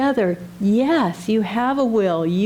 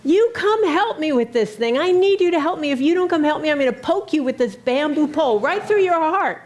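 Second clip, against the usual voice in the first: put-on drunken yell at God; 6 semitones higher than usual. Drunk young man yelling at God, mock high yell. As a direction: raise your pitch like a drunk shouting up at God. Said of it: "he was yelling at God".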